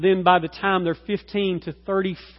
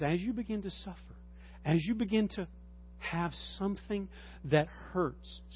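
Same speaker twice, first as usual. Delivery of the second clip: ordinary delivery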